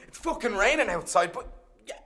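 ordinary talk

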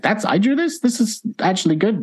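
surprised. Retold or imitated. Retold own disbelief.

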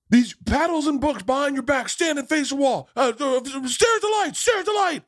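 mock drill commands. Hazing brothers barking orders.

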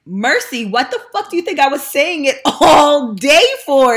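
loud, high-pitched mock outrage; exasperated retort. Retelling her reply about saying thanks all day, exasperated. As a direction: retell it as an incredulous, exasperated outburst.

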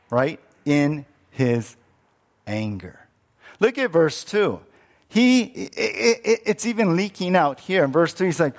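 ordinary talk, nothing marked

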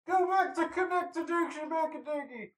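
heckling mom. Acted.

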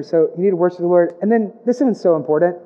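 ordinary delivery

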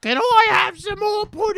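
high, loud mock-British urchin; fake British accent. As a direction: plead in a hammed-up British voice. Joins the fake British impression.

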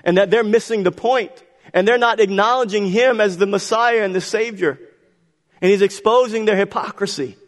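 high, pressing sermon voice; impassioned preaching. Acted. Preacher exposing the Pharisees' error to congregation, impassioned.

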